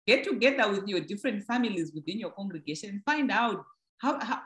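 ordinary talk, nothing marked

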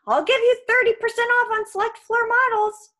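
very high, loud panicked pitch; desperate sales offer. Acted. Krusty facing the sword, shrieked desperate offer. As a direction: blurt a discount to save yourself. Trapped giant bargains for life.

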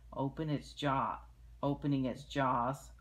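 ordinary delivery, nothing marked

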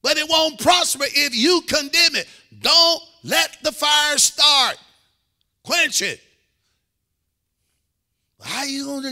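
fired-up preacher at full voice; full-force preaching. Real, not acted. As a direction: preach it hard to the congregation, punching the key words.

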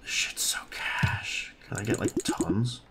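slow hushed whisper; excited whisper. Real, not acted. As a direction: whisper it in awe.

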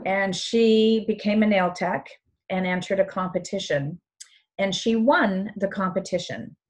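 ordinary talk

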